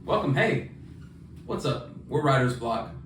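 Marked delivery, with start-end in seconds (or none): none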